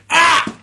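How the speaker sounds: huge, high shout of rage; furious yell. Retold or imitated. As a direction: bellow it out in fury. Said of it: "and he went".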